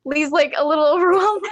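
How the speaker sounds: half-laughing through the words. Real, not acted. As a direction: voice cracking with laughter. Laughing while retelling the stabbing.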